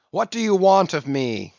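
a loud, high cry from the cornered captain; cried out. A narrator's reading. Captain to Steelkilt, crying out loud. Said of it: "cried the captain".

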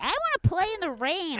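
lightly voiced high kid plea; imitating the little boy, high and whiny. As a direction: beg like an annoying small child. Annoying little kid begging.

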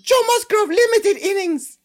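high squeaky puppet voice, slow; cheery little monster sports report; squeaky Elmo reading a stat; Elmo impression. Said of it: "Your impressions get me every".

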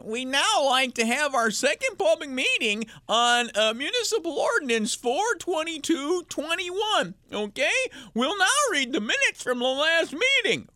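pompous meeting chair, pitched high; mock official drone. Host mocks a town meeting chair, droning. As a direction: lampoon a droning town clerk.

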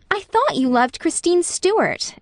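teasing reproach, pitch lifting high; mock-hurt reproach; reproachfully. A narrator's reading. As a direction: chide him lightly for loving another girl. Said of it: "as reproachfully as if".

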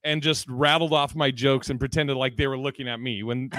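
ordinary talk

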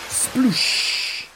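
loud, hissing "sploosh"; splash sound effect. A narrator's reading. Narrator as the boat tips over, explosive splash noise. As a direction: burst of breath imitating a big splash.

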